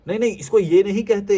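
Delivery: high, loud mocking know-it-all; mock correction. Mimicking people who correct others, mock smug.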